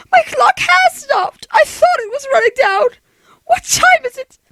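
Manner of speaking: high, tearful rising panic; alarmed. Acted. Invalid discovering her clock stopped, alarmed. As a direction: cry out in sudden dread.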